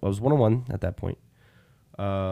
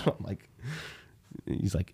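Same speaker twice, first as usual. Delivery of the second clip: ordinary delivery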